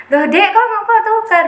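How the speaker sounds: high-pitched retold astonishment; surprised questioning. Senior asking junior about the swap, mock surprise. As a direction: imitate a taken-aback senior asking why.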